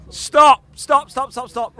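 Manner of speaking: loud high call to halt; shouting stop. Real, not acted. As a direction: bark the stop call over the room. Calling time at contest end.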